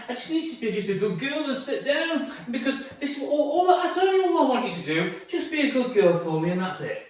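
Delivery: high, pleading owner chatter; run-on wheedling explanation; talking to dog like human. Acted. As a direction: plead with the dog as if it were a person. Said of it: "when I talk like this".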